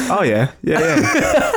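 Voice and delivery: laughing. Retold or imitated. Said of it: "I would have been like".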